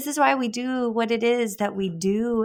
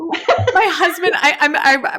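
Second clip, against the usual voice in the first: laughing, higher and louder, slowed; 6 semitones higher, 5 dB louder, slower than usual. Real, not acted. Host laughing along with guest about her husband. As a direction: break into laughter mid-sentence. Host cracking up at shared story.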